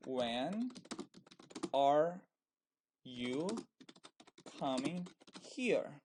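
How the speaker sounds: each word alone, long pauses between, HERE jumping up then falling; word-by-word dictation. Shown as a demonstration. Teacher giving students a new example question, word-by-word dictation.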